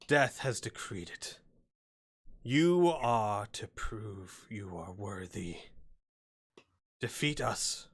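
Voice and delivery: lightly voiced sly hobgoblin, slow and menacing; a hint of a gloating goblin chief. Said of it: "the hobgoblin says".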